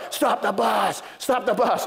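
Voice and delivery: high, urgent mock yell without much volume; retold yelling. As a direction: imitate a kid calling urgently to the driver. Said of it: "yelling at the bus driver".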